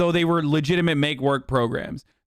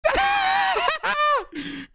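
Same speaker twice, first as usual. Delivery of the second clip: high, helpless laugh at the clip; real startled laughter; burst of laughter. Real, not acted. Streamer reacts to clip.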